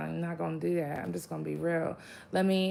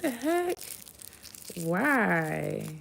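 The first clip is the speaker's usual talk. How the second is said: incredulous; much slower than usual. Retold or imitated. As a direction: act baffled and exasperated. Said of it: "You be like".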